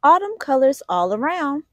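usual speaking voice

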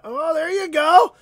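loud, high burst of joy at the pull; excited shout. Real, not acted. Pulling a star card for a viewer, an excited real shout.

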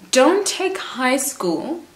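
"don't take high school" drawn out slowly, pitched high; emphatic warning. Real, not acted. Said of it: "Did you hear what I said?".

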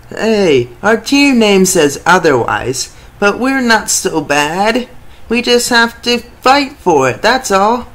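team player pep talk. Acted. Underdog player defends his team's name to viewers, loud defiant rally. Same player line repeated.

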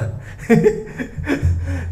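bright amused laugh; high laugh. Real, not acted. Laughs at own cockiness.

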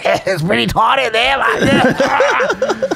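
high, laughing imitation of the traveler girl; mimicking the girl with a high giggling voice; laughing girl quote. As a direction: squeak it out through laughter as her. Retelling the traveler girl's words.

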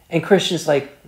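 ordinary talk

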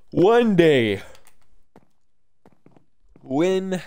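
mock grand promise. Joking about never fixing.

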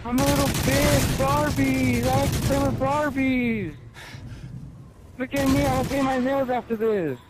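whiny crybaby impression. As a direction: squeal it like a spoiled brat throwing a tantrum. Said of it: "I whine and bitch and complain".

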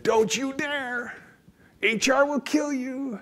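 high, slow, alarmed warning voice of a worried conscience; a panicky inner rule-keeper pleading; cautious shoulder voice. Retold or imitated. The cautious shoulder voice warns him off, high and slow. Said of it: "two voices sitting on each of my shoulders".

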